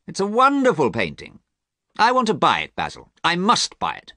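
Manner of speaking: high-pitched, emphatic delight; eager enthusiasm. Acted. Harry presses painter to sell him the painting, eager. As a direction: gush about it and press to buy.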